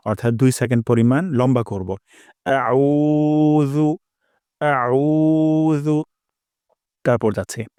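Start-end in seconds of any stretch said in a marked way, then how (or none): 2.7-6.0 "আউযু" very slow, u held two seconds, higher; one alif stretch. Shown as a demonstration. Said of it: "এক আলিফ টান দিব". ("আউযু" উ এক আলিফ টেনে)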